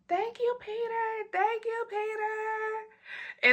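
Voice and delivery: high, soft sweet thanks; sugary thanks. Retold or imitated. Thanking Peter for money in a sweet high voice. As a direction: coo the thank-yous up high.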